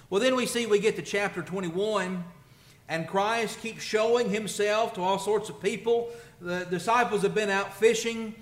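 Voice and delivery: loud, high, driving sermon voice; preaching at full force. Acted. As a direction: preach it out, rising in fervor. Preacher builds intensity.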